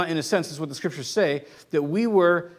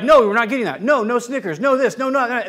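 mock firm parent, raised pitch and louder; firm parental refusal; 5 semitones higher, 3 dB louder than usual. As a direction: act out refusing a pestering child. Voices parent refusing a child's demands.

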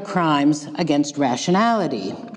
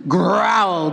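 mama bear growl; 2 semitones higher than usual. Acted. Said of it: "growled the mama bear".

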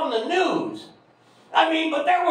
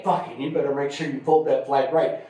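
much lower, a barked drill sergeant order; gruff sergeant; 11 semitones lower than usual. Retold or imitated. Comic recalling funeral duty, low gruff sergeant bark.

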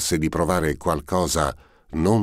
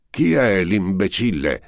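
loud, higher furious shout; furious yell; 6 semitones higher, 5 dB louder than usual. A narrator's reading. Prince yells at whoever knocks, furious shout. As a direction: yell it at the door, beside yourself. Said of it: "urlò fuori di sé".

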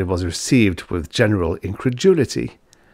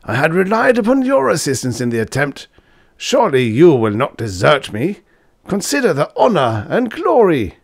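raised, urgent appeal to his pupil; pleading old professor; 8 semitones higher, 4 dB louder than usual. Acted. Professor begging his student not to desert him, urgent. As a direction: coax him with honor and glory.

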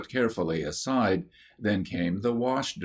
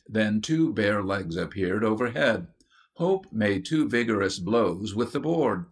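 ordinary delivery

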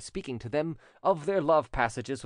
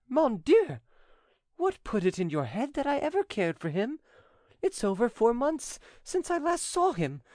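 high heroine voice in surprised denial; protesting Angelique; 10 semitones higher than usual. Acted. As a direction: protest innocence in a raised, high pitch. Voices Angelique denying the affair.

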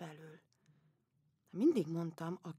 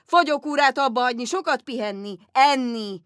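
loud, blunt doctor lecturing; shouting doctor; 4 semitones higher, 12 dB louder than usual. Retold or imitated. Rude Dutch doctor scolding the empress, loud and blunt. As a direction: bark the diagnosis at her. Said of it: "kiabált, mint apa".

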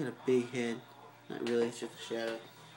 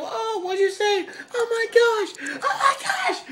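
high, loud laughing squeals; laughing fit after the spider bit; 10 dB louder, slower than usual. Real, not acted. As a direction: crack up mid-sentence, pitched way up.

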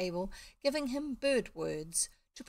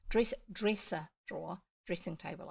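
usual speaking voice

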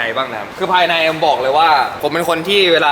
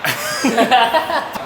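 laughing; 10 semitones higher than usual. Real, not acted. Laughs after joking about selling.

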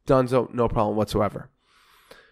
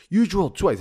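mock yell, raised pitch; retold yelling; 7 semitones higher than usual. Manager in tenant's face over drilling, mock yelling. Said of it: "yelling at me".

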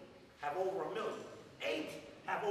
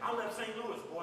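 ordinary delivery

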